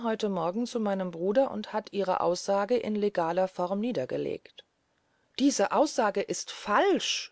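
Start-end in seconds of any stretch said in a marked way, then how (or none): 5.4-7.3 slow, higher-pitched passionate denial; impassioned outcry. A narrator's reading. Accused narrator protesting to Herr Philipp, impassioned outcry. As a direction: cry out with passion, stretching the words. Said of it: "schrie ich leidenschaftlich".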